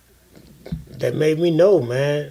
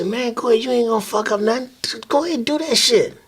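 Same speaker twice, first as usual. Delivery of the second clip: higher, egging-on tempter; the bad voice on his shoulder, pitched up; tempting devil voice; 7 semitones higher than usual. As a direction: push him to do it, higher and quicker. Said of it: "The other one will say".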